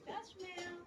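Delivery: very soft, high little voice; hushed prompt. Shown as a demonstration. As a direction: model the words softly for a toddler. Prompting again after child shouts.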